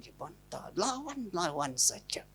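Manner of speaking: hushed, slow aside; whispered jab at a defiant wife. Real, not acted. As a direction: breathe it like a secret. Mocking the wife's defiance.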